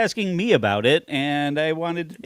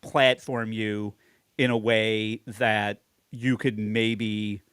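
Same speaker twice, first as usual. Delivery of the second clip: slow, lower and trailing softer; measured paraphrase; 6 semitones lower, 5 dB quieter, much slower than usual. Retold or imitated. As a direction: spell out the offer slowly, letting it sink away. Paraphrasing host offering guest a platform.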